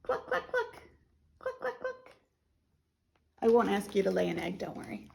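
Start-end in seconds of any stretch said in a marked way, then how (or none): 0.0-2.1 high, soft clucks; quick little high hen noises; hen clucking. Acted. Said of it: "Say cluck".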